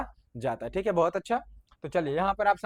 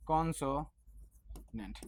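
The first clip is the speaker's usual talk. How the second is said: drawn-out word; 5 semitones lower, 11 dB quieter, much slower than usual. Shown as a demonstration. Teacher stretching word for class.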